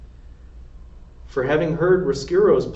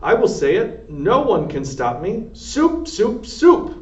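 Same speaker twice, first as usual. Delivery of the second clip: louder, higher defiant yelling; defiant shouting; 8 semitones higher, 5 dB louder, slower than usual. A narrator's reading. Cook defying Louise with shouted cries of soup. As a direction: shout it so nobody can stop you. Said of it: "shouted the cook".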